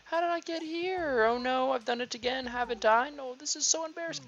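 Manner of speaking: high, slow sleepy villager; Nosuke voice. Acted. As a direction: wake up dazed and fussy. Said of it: "what kind of voice to do with Nosuke".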